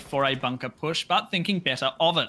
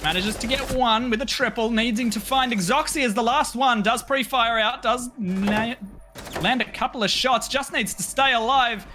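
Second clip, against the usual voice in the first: excited play-by-play; 8 semitones higher than usual. Acted. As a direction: scream the clutch call. Clutch round climax.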